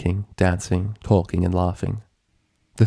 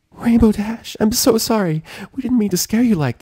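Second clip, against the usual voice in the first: Fluttershy coaxing. A narrator's reading. Fluttershy soothing frightened Rainbow, high gentle coaxing. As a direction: gently coax a scared friend. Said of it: "Fluttershy coaxed".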